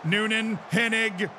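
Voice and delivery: excited commentator building to a roaring goal call; high, shouted goal commentary; live play-by-play. Pretend. Mock goal commentary on the match.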